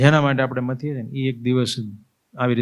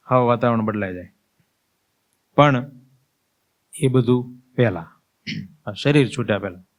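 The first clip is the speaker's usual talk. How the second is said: ordinary talk, nothing marked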